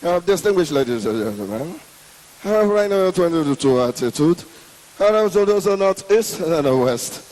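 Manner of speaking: low, mumbled pilot voice over the intercom; pilot's unclear crash announcement to the passengers; garbled pilot announcement. Retold or imitated. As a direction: mumble it like a muffled PA. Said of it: "you will not hear clear".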